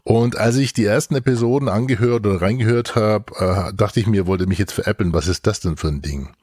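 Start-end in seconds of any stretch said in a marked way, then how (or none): none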